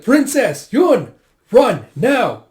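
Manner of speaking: high, very loud urgent command; urgent shout. Acted. Companion orders princess and Yun to flee, urgent shout. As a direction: shout each word to get them fleeing.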